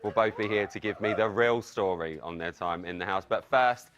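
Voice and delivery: very low, soft, level voice; teasing seductive introduction. Acted. Host teasing the arrival of his very own hot male, seductive. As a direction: drop right down into a low, hushed purr.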